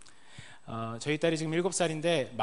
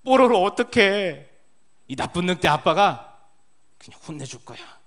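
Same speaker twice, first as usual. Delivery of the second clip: high, much louder, slow storytelling play voice; playing along for a child; 4 semitones higher, 14 dB louder than usual. Dad acting out Pororo for his little daughter, hammed-up play voice. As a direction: ham it up for a four-year-old. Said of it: "제 모습이 더 난해합니다".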